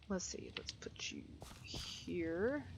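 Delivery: hushed whisper to the animals; soft unvoiced whisper. Real, not acted. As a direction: breathe it, no voice.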